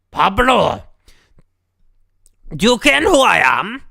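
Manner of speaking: put-on loud booming Scottish accent; loud Scottish governor. Prison governor introducing himself to visitors, loud Scottish accent. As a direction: boom it out as a proud Scottish governor.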